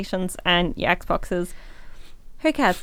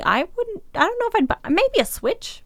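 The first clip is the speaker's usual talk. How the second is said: high-pitched; 12 semitones higher than usual. Real, not acted. Hypothetical parent musing.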